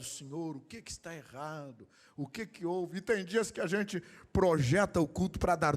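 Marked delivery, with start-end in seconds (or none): 0.0-3.0 hushed, troubled plea to God; much softer, as if praying privately in worry; worried prayer. Retold or imitated. Pastor asking the Lord after a bad service, worried prayer.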